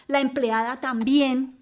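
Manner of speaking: ordinary delivery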